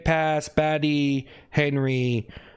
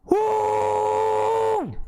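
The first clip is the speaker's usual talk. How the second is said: cheering woo. Real, not acted. Streamer thanking viewers for gifts, high whooping shout. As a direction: whoop for the gift.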